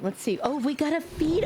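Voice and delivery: usual speaking voice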